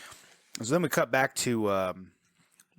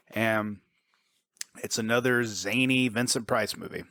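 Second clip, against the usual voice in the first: ordinary talk, nothing marked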